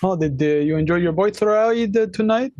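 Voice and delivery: normal talking voice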